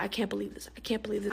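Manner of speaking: soft, stunned mumble under the breath; mumbling to herself. Retold or imitated. Mother in disbelief after reading his phone, mumbling softly. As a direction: repeat it quietly as if in shock. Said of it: "she just keeps mumbling to herself".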